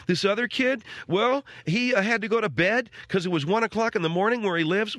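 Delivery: put-on high kid voice, every phrase lifting up at the end; imitating Alex. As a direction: mimic a young son who ends everything on a rise. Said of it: "everything's up at the end of a sentence with him".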